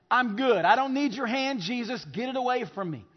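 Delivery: mock-defiant rejection; dismissive refusal. Acts out people refusing Jesus.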